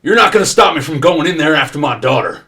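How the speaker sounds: loud, high angry yell at the officer; arguing angry father. Acted. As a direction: shout it like a furious dad. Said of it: "the couples are arguing with him".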